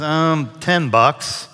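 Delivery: slow, casual shrug of a price; offhand. Retold or imitated. Dog owner to buyer, slow and offhand. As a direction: drawl it out like it's nothing.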